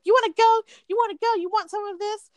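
high-pitched imitation of squaring up; mock fighting challenge. Retelling an angry customer pressing against a man, mock confrontational. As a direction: imitate someone itching for a fight, voice way up.

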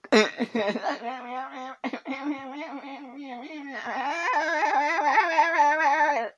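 high wheezing laugh fit; helpless laughter, almost crying; real breathless laugh; high cackling laugh. Real, not acted. Laughing fit after farting.

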